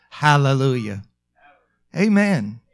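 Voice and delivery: ordinary talk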